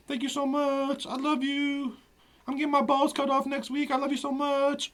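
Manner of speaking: high squeaky pet voice; high dog voice. Acted. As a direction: speak for the dog, sweet and high. Voicing the dog's goodbye.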